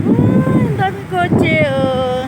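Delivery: drawn-out amazed exclamation. Real, not acted. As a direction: marvel at all the cars, slowly. Amazed at the many cars.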